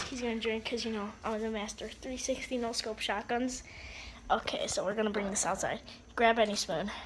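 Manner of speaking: usual speaking voice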